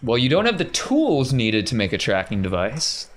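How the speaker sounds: usual speaking voice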